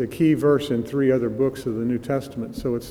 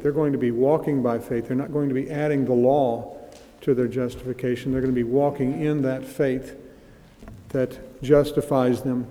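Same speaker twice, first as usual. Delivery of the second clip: ordinary talk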